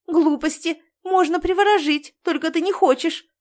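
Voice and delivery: high, loud accusing protest; heated retort. A narrator's reading. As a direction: throw the objection back at her. Girl argues back at grandmother.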